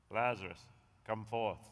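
low, grand mock British accent. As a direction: imitate Jesus as a solemn British actor. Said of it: "He did it with a British accent".

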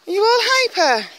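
high, bright voice for the dog; high pet talk. Real, not acted. Talking to her excited dog in a high pet voice.

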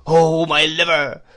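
put-on loud, high, drawn-out old man's whine; swelling singsong cry; wailing cry. A narrator's reading. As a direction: wail it slowly as a crazed old man. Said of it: "cried the old man".

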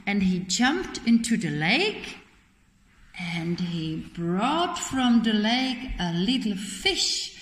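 ordinary delivery

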